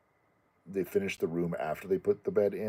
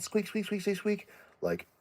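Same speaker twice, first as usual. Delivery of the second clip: squeak imitation.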